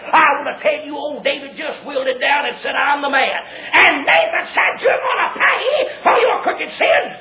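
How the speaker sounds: high shouted preacher's storytelling; impassioned revival holler; full-force preaching. Acted. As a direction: build the confrontation to a crying climax.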